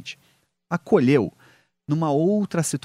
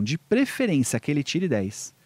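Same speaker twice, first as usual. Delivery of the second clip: normal talking voice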